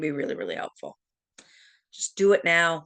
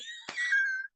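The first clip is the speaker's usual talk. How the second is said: high laugh; 6 dB quieter than usual. Real, not acted. Laughing at own story.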